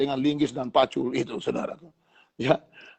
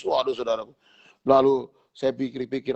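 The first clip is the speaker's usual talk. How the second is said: usual speaking voice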